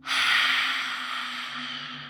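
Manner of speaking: long, airy exhale fading to silence; final deep sigh. Shown as a demonstration. Guide models the last exhale of the practice, deep sigh. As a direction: breathe out fully in one audible sigh. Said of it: "your final deep sigh of the practice".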